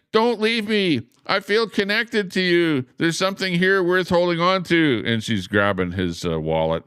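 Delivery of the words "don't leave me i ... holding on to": much higher, clingy woman's voice; pleading woman. Retold or imitated. Streamer reading the cartoon woman's lines, high and pleading. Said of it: "the woman is saying".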